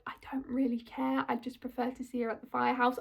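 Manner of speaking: hushed, breathy confession of a thought; quietly voicing her own bored reaction; whispered aside. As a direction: whisper it like an inner voice you'd rather hide. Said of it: "and I was like".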